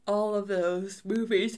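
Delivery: talking through a yawn; words stretched by a yawn. Real, not acted. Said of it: "I keep yawning".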